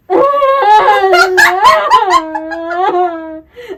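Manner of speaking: long, high, loud laughing wail; laughing mock wail. Still retelling the crying on her chest, long loud laughing mock wail. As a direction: wail it like a crying kid, cracking up. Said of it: "And I was like".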